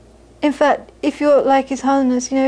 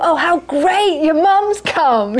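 higher, slowed gushing welcome voice; delighted congregants; 5 semitones higher, slower than usual. Retold or imitated. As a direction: gush over the visiting mum, drawn out. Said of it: "'cause they thought".

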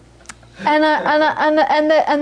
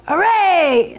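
mock cheer of hooray, pitched up; 6 semitones higher than usual. Monk cheering his team's goal, lightly cheering. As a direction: cheer like a happy fan.

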